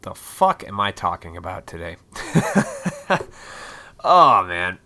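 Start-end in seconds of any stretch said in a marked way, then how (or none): 2.0-4.0 breathy burst of laughter; laughing. Real, not acted. Podcaster laughing at his own rambling, amused laughter. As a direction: crack up at yourself.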